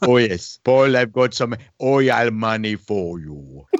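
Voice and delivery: put-on slow, drawn-out Nigerian accent; Nigerian prince accent. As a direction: play a Nigerian prince selling riches, slowly. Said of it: "you know the prince too".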